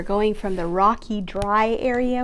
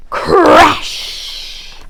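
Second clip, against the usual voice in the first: crash; 6 semitones higher, 9 dB louder than usual. Acted. Crash on the bridge.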